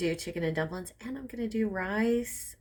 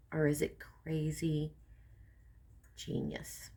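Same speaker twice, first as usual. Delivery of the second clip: conspiratorial whisper; 3 semitones lower, 6 dB quieter, slower than usual. Acted. Teasing whispered question.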